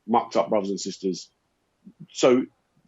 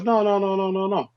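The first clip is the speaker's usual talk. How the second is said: hasty denial; 9 semitones higher than usual. Retold or imitated. Retells woman's hasty denial.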